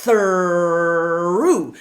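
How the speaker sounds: "through" loud with a growled R after a voiceless TH; growly R in through. Shown as a demonstration. Teacher modelling a loud monster R in "through" for students. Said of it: "voiceless T H".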